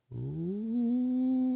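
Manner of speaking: usual speaking voice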